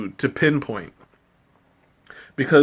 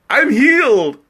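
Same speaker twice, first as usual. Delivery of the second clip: hammed-up miracle cure, higher and louder; mock triumphant; 9 semitones higher, 4 dB louder, slower than usual. Streamer telling chat her cough is gone, mock triumphant. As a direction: proclaim it like a faith healing, tongue in cheek.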